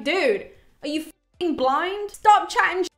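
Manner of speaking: a touch of a hothead losing it; hot-headed Ajax. Retold or imitated. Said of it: "has a f***ing anger management problem".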